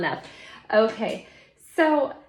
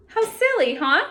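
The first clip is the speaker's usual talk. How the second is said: playful exclaim; 8 semitones higher, 7 dB louder than usual. Real, not acted. Pretend attentoscopes joke.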